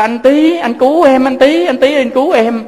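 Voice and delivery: put-on high, loud child's scream for his big brother; frightened child crying out; desperate cry for help. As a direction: call out to be rescued, terrified.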